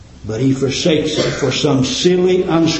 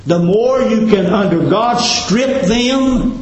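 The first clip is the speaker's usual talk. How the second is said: preaching climax; 7 semitones higher than usual. Acted.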